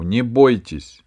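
loud, slow call to calm him; loud cry of Не бойтесь; shouting reassurance. A narrator's reading. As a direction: shout so the frightened man hears. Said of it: "закричала она".

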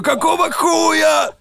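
high, slow shocked shout; shocked yell. Retold or imitated. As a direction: yell in disbelief at the screen. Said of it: "ты такой".

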